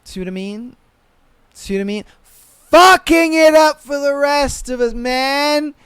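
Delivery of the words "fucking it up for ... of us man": louder, slower exasperated rant; loud slow complaint. Real, not acted. Reacting to the cheating boyfriend, loud slow rant. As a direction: drag it out, fed up.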